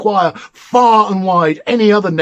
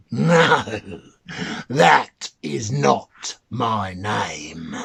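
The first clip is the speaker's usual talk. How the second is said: very slow whisper; mannikin whispering; 7 semitones lower, 4 dB quieter, much slower than usual. Acted. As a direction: breathe it slowly, smug and secret. The mannikin refuses again.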